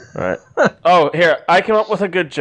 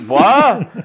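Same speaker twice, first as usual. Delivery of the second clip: startled, raised "What?"; a mock shocked reply, pitched up; 6 semitones higher, 6 dB louder than usual. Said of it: "And she's like".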